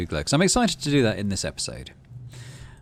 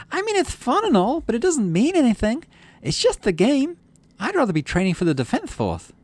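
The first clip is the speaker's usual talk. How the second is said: put-on incredulous girl; 5 dB louder than usual. Girl doubting they still play sports ball, high incredulous voice. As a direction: play her amazed you mean it.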